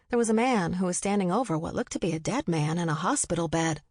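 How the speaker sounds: usual speaking voice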